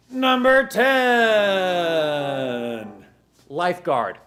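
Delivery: drawn-out number, quick deadpan item; countdown host. Acted. Host counting down summer jobs, game-show announcer. Countdown list read over music.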